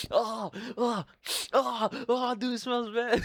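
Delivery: put-on high squealing kid voice, half laughing; gagging at the smell in a high mock kid voice. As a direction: squeal it like a grossed-out ten-year-old. Boys gagging at stinky toilet.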